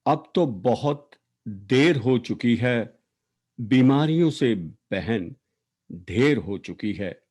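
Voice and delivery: emphatic, declaimed verse, line endings falling away; poetry recitation. Acted. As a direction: recite an elegy with weighted words and long pauses. Recites own poem for late sister.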